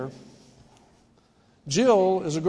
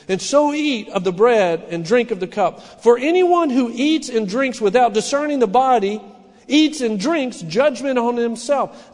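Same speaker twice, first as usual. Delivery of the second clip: scripture reading; 3 semitones higher, 4 dB louder than usual. Acted. Reads scripture passage aloud.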